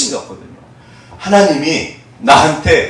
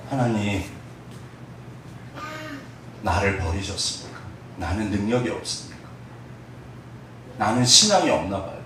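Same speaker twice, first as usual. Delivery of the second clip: low, much softer lament; despairing prayer; 7 semitones lower, 10 dB quieter than usual. Retold or imitated. Discouraged believer softly asking God if abandoned, low. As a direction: sink into a hushed, hopeless plea.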